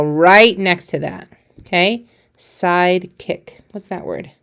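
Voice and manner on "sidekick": slow modelling. Shown as a demonstration. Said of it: "teacher models the word slowly".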